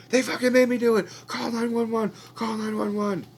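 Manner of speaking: high, loud panicked yell; frantic shouting. Retold or imitated. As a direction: shout the warning down the phone. Captive shouts message to wife.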